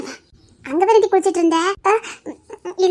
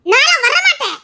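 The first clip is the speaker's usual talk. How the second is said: loud refusal; 8 semitones higher, 5 dB louder than usual. Acted. Wife refuses to enter the water.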